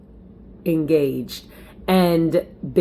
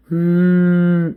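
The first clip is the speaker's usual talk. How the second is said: labor moan; 5 dB louder than usual. Retold or imitated. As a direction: moan through a contraction. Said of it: "you know, doing the moan".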